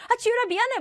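high-pitched, acted apology; lightening the mood. Brother trying to change the mood, high playful apology. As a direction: butt in with an eager sorry. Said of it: "분위기를 바꿔보려고".

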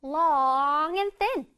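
very slow, every word drawn out; slow teacher model sentence; slow model reading. Shown as a demonstration. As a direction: read it out slowly for the kids to copy. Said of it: "One more time".

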